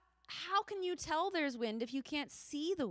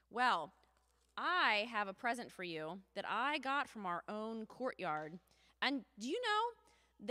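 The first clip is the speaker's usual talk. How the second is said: ordinary talk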